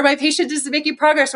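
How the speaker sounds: high mock complaint; whiny therapist. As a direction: play up the exasperated colleague. Mocking a complaining clinician.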